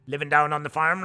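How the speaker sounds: put-on Norfolk farm talk; mock Norfolk country accent; thick Norfolk accent. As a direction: imitate a thick rural Norfolk speaker. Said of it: "a kind of a real thick".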